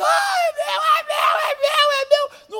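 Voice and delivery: high, loud wailing cry; two mothers crying over one baby, loud wail. Retold or imitated. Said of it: "Duas mães chorando".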